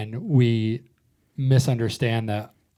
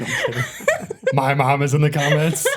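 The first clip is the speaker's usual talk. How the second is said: laughing; 4 semitones higher than usual. Real, not acted. Laughing after joke.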